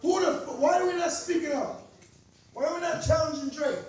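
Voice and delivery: normal talking voice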